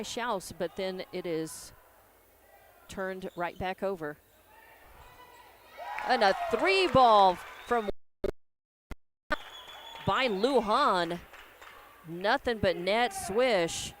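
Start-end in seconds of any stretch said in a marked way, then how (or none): 6.1-13.9 loud play-by-play for a made three; excited. Real, not acted. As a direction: lift the voice for the shot. Exciting made three-pointer call.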